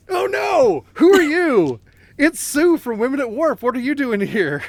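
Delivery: high, loud fake shock; hammed-up surprised gasp; mock surprise. Mock surprise greeting guest.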